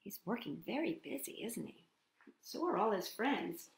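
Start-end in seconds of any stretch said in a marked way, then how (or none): none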